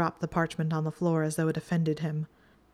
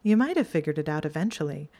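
snide teasing; 6 semitones higher than usual. A narrator's reading. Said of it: "shooting him a snide smirk".

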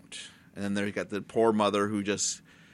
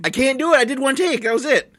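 much higher and louder indignant whine; mock protest; 13 semitones higher, 7 dB louder than usual. Host refuses cohost's retake, high and whiny.

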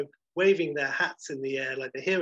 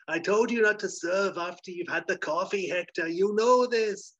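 higher-pitched, acted scolding; berating; 7 semitones higher, 4 dB louder than usual. Bartender's wife berates him for serving after coffee, scolding. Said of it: "comes out and berates him".

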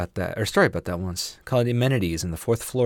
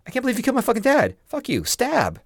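mock outraged son. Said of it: "her son was like".

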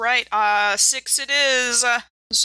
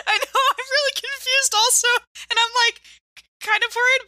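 laughing. Real, not acted. Players laugh at confusing twist.

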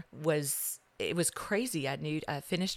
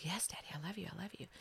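put-on a small girl's hushed, breathy whisper, much softer; whispered little girl; 2 semitones higher, 11 dB quieter, faster than usual. Little girl whispering to her daddy at bedtime, hushed and sweet. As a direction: whisper it like a sleepy child at bedtime.

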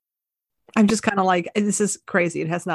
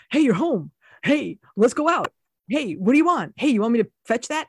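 higher, eager mock dog voice; 4 semitones higher than usual. Voicing a dog greeting its owner, lightly eager. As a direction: play an excited puppy greeting you. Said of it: "A dog is like".

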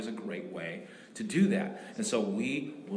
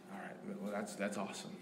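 soft approval; 2 semitones lower, 10 dB quieter than usual. Retold or imitated. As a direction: say it gently, almost to yourself. Pastor replays approving reply.

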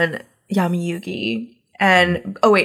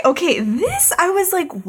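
usual speaking voice

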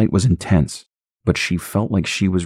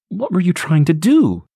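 frightened mother; 13 semitones higher than usual. Acted. Stacy confronts the baby.

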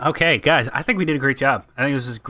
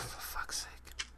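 whispered exasperation, very soft; a hushed, drawn-out groan of protest; exasperated whisper; 24 dB quieter than usual. Real, not acted. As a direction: mutter it slowly under your breath in disbelief. Exasperated aside at co-host's pick.

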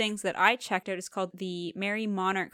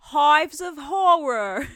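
put-on slow, much higher, louder British movie-trailer accent; bad British accent; 8 semitones higher, 11 dB louder, much slower than usual. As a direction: drag it out like a hammy British trailer. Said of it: "we're done with our bad accents".

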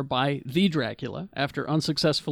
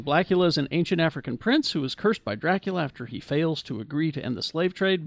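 usual speaking voice

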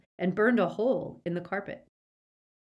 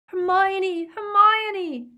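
high, loud, frantic repeated calls; shouting; 10 dB louder, much slower than usual. A narrator's reading. Boy calls to tortured friend above, frantic shouting. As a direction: yell the name desperately, higher each time. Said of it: "Ron stopped shouting".

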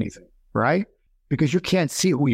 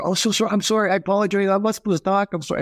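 higher, rushed backpedaling sorry; flustered apology; 8 semitones higher, faster than usual. Retold or imitated. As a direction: scramble to take it back. Hastily apologizing after outburst.

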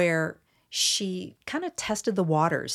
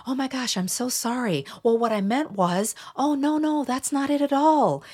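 high-pitched, gushing apology; fawning; 7 semitones higher than usual. Acted. People pleaser triggered by discarding language, fawning apologetically. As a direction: scramble to placate in a much higher voice. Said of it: "it can trigger that fawning behavior".

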